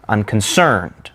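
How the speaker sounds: unconcerned drawn out slowly, weight on CERNED; anapest stress. Shown as a demonstration. Teacher giving an anapest example, slow un-con-CERNED. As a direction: land on the last syllable of "Unconcerned".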